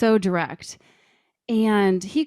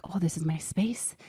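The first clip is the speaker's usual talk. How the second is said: whispered, claiming her space; hushed and possessive, under her breath; retold whisper; 6 dB quieter than usual. Recalling guarding the kitchen from family, whispered. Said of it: "I was like".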